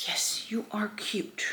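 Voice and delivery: soft whispered coo; whispering. Real, not acted. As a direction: whisper it sweetly, like to a pet. Cooing at a pet or baby.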